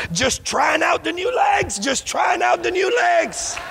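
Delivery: high, slow, joyful call; beaming with his new legs; healed man showing off, high and slow. Retold or imitated. Don quoted, joyful.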